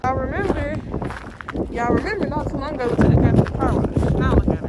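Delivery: loud, fast excited calling out. Real, not acted. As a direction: call out over the cold wind. Shouting over snow outside.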